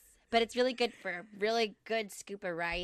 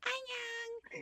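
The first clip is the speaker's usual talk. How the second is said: put-on high, tiny toddler voice saying Annyeong; toddler greeting; 7 dB quieter than usual. Imitating toddler niece greeting her back, higher and softer. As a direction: imitate a little kid's sweet hello. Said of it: "then she was like".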